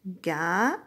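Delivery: "Gâteau" as two separate syllables, long pause between. Shown as a demonstration. Teacher taps "Gâteau" together with the child, syllable by syllable. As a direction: draw out "gâ" with a rise, pause, then a short "teau". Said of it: "deux syllabes".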